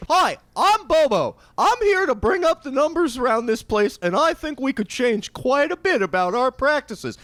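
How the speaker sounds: high, loud, chipper clown voice; perky clown boss greeting staff; silly clown. Acted. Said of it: "clown manager voiced".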